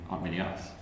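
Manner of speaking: ordinary talk, nothing marked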